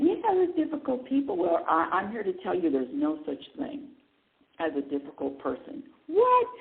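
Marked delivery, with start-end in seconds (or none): 0.0-1.4 high imitation of keen hand-raisers; mock enthusiasm, pitched well up; eager agreement. Imitates people raising their hands. 6.1-6.5 loud, very high mock shock; incredulous. Acts out shocked listener's reply.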